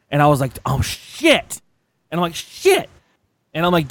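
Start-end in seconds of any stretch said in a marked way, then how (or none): 0.7-1.6 drawn-out mock "oh no" realisation; hammed-up sinking realisation; dawning dread. Retold realisation at customs. 2.3-2.9 very high, squeaked curse; tiny high-pitched yelp of dread; mock panic. Retold panic at customs, repeated.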